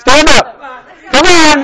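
high, loud rallying shouts; loud urging. Real, not acted. Coach rallying a hesitant volunteer, loud urging. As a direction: shout to get them going.

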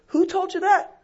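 wife doubting Noah's source; skeptical wife. Acted. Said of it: "Noah's wife says".

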